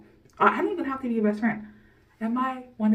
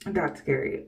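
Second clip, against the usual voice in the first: much lower, voice suddenly deeper; voice dropped; 6 semitones lower, slower than usual. Real, not acted. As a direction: let the voice drop low by surprise. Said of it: "my voice changed".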